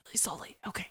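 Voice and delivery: quick, hushed agreement; hurried whisper. Acted. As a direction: whisper it fast and nervously. Whispering while peeking out.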